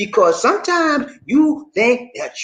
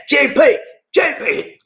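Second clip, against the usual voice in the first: loud, raised call; calling out to someone; 8 semitones higher, 3 dB louder, slower than usual. Real, not acted. Host summoning his helper, calling out high. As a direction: call JP to attention.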